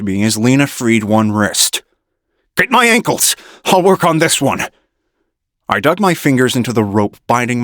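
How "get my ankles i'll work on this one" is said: urgent shout. A narrator's reading. Urgent call during fight.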